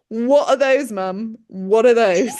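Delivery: loud teasing questions; loud retold cheeky outburst; mock astonishment. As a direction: hit both questions hard, ribbing Mum. Son teasing Mum about knickers.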